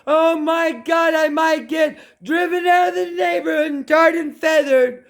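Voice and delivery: mock panic. Mock panic over offending neighbours.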